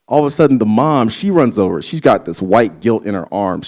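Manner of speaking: usual speaking voice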